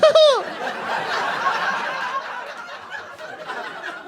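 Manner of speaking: very high cartoon giggle; high put-on "hoo-hoo" laugh; crazy dad's whoop. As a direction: whoop like a gleeful leprechaun. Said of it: "He's got this crazy voice".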